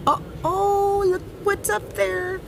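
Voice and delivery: high, amazed "oh, oh" pointing upward; excited wonder. Acted. People in the story looking up, high and amazed. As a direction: gasp it out like spotting something wonderful overhead. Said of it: "looking up saying".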